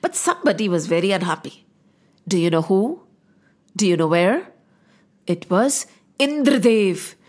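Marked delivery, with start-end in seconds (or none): none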